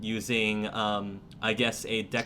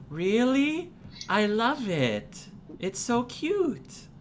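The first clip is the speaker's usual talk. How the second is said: put-on high, slow, gushing child voice; a small girl thrilled by a gift; delighted little girl; 12 semitones higher, slower than usual. Girl to Arkara about the pancake, in a high, slow, delighted child voice.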